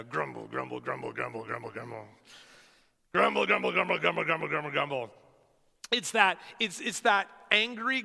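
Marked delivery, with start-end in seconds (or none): 0.0-3.7 low grumbling sound. Shown as a demonstration. Pastor sounding out grumble to the church, low and rumbling. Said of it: "meaning that it sounds like what it is". 3.7-5.1 grumbling sound. Shown as a demonstration. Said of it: "meaning that it sounds like what it is".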